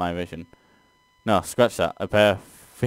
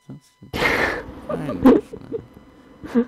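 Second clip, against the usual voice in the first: strained laugh; much slower than usual. Real, not acted. Forced acceptance, laughing.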